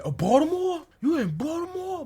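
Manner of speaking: high mock Baltimore voice, way up; mocking Baltimore folks. Mocking how Baltimore people sound to him. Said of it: "I still don't understand why they talk like that".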